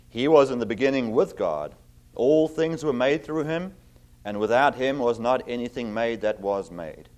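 ordinary talk

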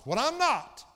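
loud, high burst on "what I'm not"; sudden full-force preaching cry; emphatic shout. Real, not acted. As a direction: shout the repeat from the pulpit.

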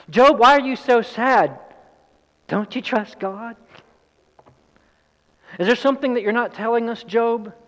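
high, loud accusing questions; condescending accusation. Acted. Friend accusing Job in the form of a question, condescending. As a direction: question him as if he is hiding guilt. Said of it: "It's an accusation in the form of a question".

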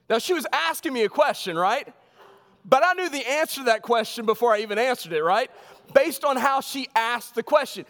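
high, lively sermon story voice; animated preaching. Acted. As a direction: sell the joke to the congregation.

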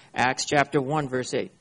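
normal talking voice

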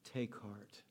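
tender. Acted. Jesus has pity on him.

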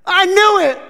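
high, loud shriek of panic; hammed-up terrified fishermen yelling; panicked disciples. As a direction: scream it like you've seen a ghost. Disciples panic seeing ghost.

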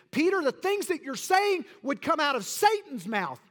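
loud, high shouted rebuke; stern rebuke. Acted. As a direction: shout it down at Peter, high and hard. Jesus rebuking Peter, voiced.

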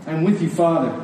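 normal talking voice

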